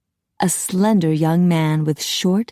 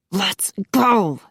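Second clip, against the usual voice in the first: hissed urging; 3 semitones higher than usual. A narrator's reading. Phil wants out of the salon, mild hiss. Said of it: "Phil hissed".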